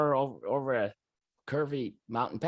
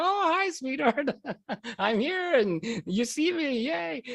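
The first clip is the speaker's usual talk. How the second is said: much higher, gleeful hello breaking into a laugh; joyous greeting; 9 semitones higher than usual. Retold or imitated. Spirit greets his wife from above, joyous and high. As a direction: sing out hi like pure joy. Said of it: "All I know is this total joyousness".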